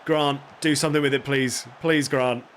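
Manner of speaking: ordinary talk, nothing marked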